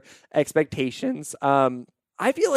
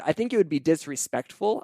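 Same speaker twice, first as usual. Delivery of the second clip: ordinary talk, nothing marked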